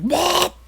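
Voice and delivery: a sudden high yelp; mock yelp. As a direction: yelp as if caught by pain. Retold yelp from sex mishap.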